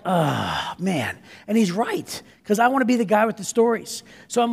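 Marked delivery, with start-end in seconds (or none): 0.0-1.2 low, defeated ah man; caving in to his friend's dare; resigned groan. Retold or imitated. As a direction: give in with a lower sigh of a word. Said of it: "I'm like".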